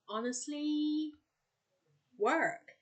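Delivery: high, loud, drawn-out mimicked quip; funny catchphrase imitation. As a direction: stretch each word out high and punchy. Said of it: "it's just so funny how they say it".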